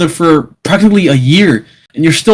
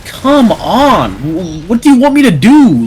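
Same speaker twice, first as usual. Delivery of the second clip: exasperated plea; 5 semitones higher than usual. Retold or imitated. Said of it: "I'm like".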